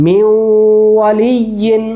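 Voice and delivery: "মিউ" held nasally before "ওয়ালিয়িন"; merged with ghunnah. Shown as a demonstration. Teacher models recitation, "মিউ" held with a light nasal hum. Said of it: "গুন্না হয়ে গেল".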